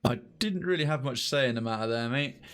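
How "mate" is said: ordinary talk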